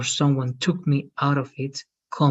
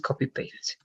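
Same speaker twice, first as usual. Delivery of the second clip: whispered aside; 9 dB quieter than usual. Real, not acted.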